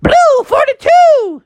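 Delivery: very high, stretched quarterback call; Brock Purdy. Acted. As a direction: yell out the play, drawn long. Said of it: "old Brock Purdy yell out".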